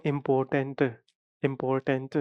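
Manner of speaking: "इंपॉर्टेंट" sounded out carefully, syllable by syllable; pronunciation demo. Shown as a demonstration. Teacher showing the mouth opens several times, careful "इंपॉर्टेंट". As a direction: say "इंपॉर्टेंट" deliberately, each mouth opening distinct. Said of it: "मेरा जो मुंह open हो रहा है".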